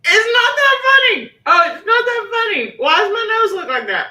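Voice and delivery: high, very loud cry after a laughing fit; loud exasperated protest. Real, not acted. Protesting amid her own laughing fit.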